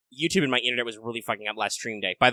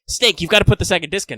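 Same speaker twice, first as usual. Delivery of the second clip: lightly voiced nagging sidekick, a bit higher; Otacon impression again; 5 semitones higher, 5 dB louder than usual. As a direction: Otacon calls Snake about the disc, mock nagging. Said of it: "he calls you and he's like".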